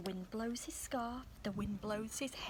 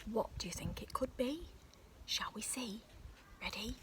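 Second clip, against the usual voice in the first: plain reading voice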